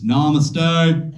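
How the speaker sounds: "Namaste" in a twangy Southern drawl, ending "namasto"; Southern woman greeting, twangy drawl. Retold or imitated. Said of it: "But she's from the South".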